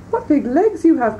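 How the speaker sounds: put-on girl questioning the disguised wolf in wonder; wondering little girl. A narrator's reading. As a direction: marvel at it like a small girl.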